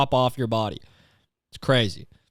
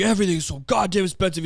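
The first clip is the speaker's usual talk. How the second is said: higher-pitched mock rant; worked-up imitation of a complainer; imitated tirade; 6 semitones higher than usual. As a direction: imitate a man ranting in exasperation. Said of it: "a tirade about".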